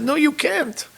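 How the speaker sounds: high, overwrought retold protest; emotional refusal. Rabbi refusing the Maharsha's wish to stay hidden, emotional refusal.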